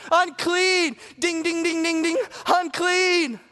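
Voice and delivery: shouted leper's warning, pitched high; warning shouts. Retold or imitated. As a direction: call out to keep the clean people away. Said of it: "shout out at the top of their lungs".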